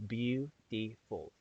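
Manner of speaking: "Beautiful" slowly in three beats, beau, ti, ful; "Beautiful" drawn out with gaps between its syllables; split into syllables. Shown as a demonstration. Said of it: "three syllables".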